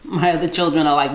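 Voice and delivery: ordinary delivery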